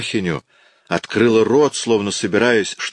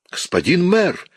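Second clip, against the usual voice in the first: much higher, desperate cry for help; terrified cry; 11 semitones higher than usual. A narrator's reading. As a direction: cry out in horror as he is seized. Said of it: "вскричала Фантина".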